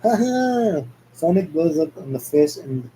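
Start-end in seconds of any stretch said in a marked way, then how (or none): 0.0-0.8 triumphant laugh. Acted. Sonic triumphant.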